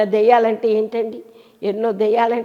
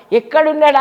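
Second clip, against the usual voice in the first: higher, louder call of ఎక్కడున్నాడా; anxious searching call; 5 semitones higher, 5 dB louder than usual. Acted. Mary at the tomb calling out for her Lord, louder and anxious. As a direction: cry out ఎక్కడున్నాడా, rising in urgency.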